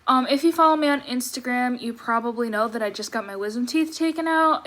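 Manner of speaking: usual speaking voice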